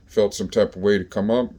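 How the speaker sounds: ordinary talk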